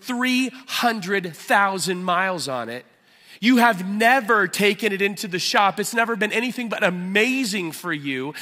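loud, high, driving sermon voice; impassioned pulpit preaching; full-force preaching. Acted. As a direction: preach it out with fire to the room.

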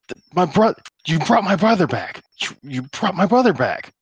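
high, loud, stammering joy; overjoyed disbelief. Acted. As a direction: blurt it out as if he can't believe it. NPC overjoyed, brother returned.

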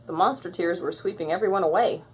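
ordinary delivery

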